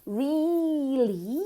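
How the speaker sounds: "really" with a long, drawn-out ee. Shown as a demonstration. Teacher modelling "really" for learners, very long ee. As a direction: stretch the first vowel of "really" right out. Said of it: "Es con una I larga".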